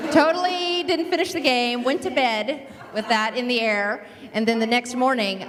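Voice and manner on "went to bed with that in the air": normal talking voice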